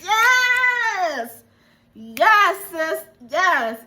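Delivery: high, loud hype for a friend; hyped cheering. Retold or imitated. Hyping up the actress's makeover, high excited cheering. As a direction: cheer her on, sis. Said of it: "I was like".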